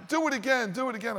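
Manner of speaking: put-on high, eager child begging; excited kid. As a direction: beg like a kid in the pool. Teen kids still begging.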